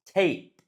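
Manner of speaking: tape with long ay glide. Shown as a demonstration. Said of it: "you say them differently".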